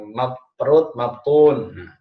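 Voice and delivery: ordinary talk, nothing marked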